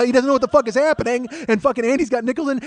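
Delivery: usual speaking voice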